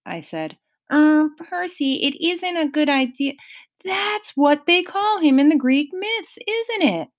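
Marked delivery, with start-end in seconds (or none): 0.9-3.3 a hint of a worried satyr; uneasy warning; nervous Grover. A narrator's reading. Grover hesitant, higher voice. 3.8-7.1 higher, insistent question; pressing for an answer; a touch of demanding. A narrator's reading. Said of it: "I demanded".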